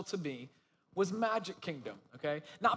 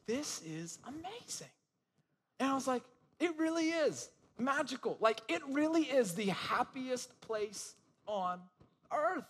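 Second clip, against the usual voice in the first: awed wonder; 6 semitones higher than usual. Retold or imitated. Fireworks over castle, telling wife.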